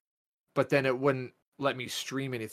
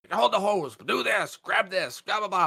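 high, louder mimicked barrage of orders; mock yelling; 8 semitones higher, 4 dB louder than usual. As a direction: imitate a mad coworker yelling out orders. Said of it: "you just start yelling at him".

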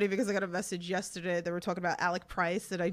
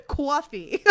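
New York accent; 5 semitones higher than usual. Shown as a demonstration. Showing off an accent word to a guest, New York accent. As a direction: exaggerate the New York vowel in coffee. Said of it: "it's with A's".

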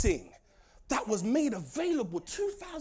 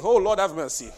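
usual speaking voice

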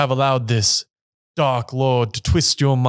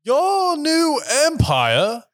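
high, slow, disbelieving; mock incredulous; 14 semitones higher, much slower than usual. Obi-Wan mocking Anakin's claim, slow and high. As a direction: stretch it out in mock disbelief, pitched way up.

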